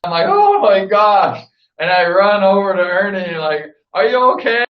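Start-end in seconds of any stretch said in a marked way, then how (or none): 0.3-1.4 slow, loud, high oh my gosh; startled at the crash; shocked gasp. Retold or imitated. Narrator seeing Ernie fall, shocked and loud. 3.9-4.6 high, urgent call down the hole; worried call. Retold or imitated. Calling down to Ernie after his fall, worried.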